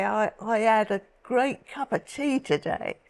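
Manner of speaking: a light Cockney accent, dropped H's; a hint of London Cockney, cheerfully. Shown as a demonstration. Showing the host how Cockneys talk, light Cockney accent. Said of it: "they say".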